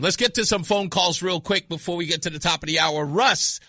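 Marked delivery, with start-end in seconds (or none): none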